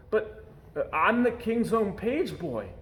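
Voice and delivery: a touch of breathless disbelief; stunned protest; gasping in shock. Acted. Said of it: "gasped Eric".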